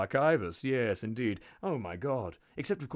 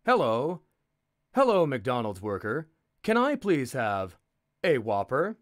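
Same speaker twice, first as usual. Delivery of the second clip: loud, over-polite fast food order; hammed-up polite customer; 3 semitones higher, 7 dB louder than usual. Narrator redoing the McDonald's order for Americans, loud and sweetly polite. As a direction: greet the counter worker with exaggerated courtesy. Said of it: "hold up".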